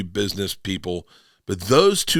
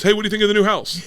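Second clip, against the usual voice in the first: a much higher, louder, chirpy dad greeting; a high, bright imitation of his father; cheery dad; 10 semitones higher, 4 dB louder, much faster than usual. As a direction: play the proud oblivious dad showing off. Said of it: "my dad walks in ... he goes".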